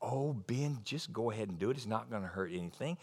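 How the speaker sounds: soft, sly coaxing of the devil; tempter's whisper. Retold or imitated. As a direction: lure him in gently. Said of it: "He's the one that tells you".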